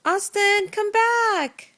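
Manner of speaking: loud call across the room. Real, not acted. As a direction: call him back, loud and high. Mother calling child back.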